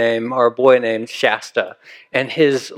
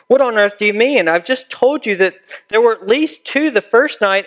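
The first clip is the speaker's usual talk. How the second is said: a lightly voiced young boy, higher, bewildered; boy's indignant protest; 8 semitones higher than usual. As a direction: play Shasta, puzzled and a bit cross.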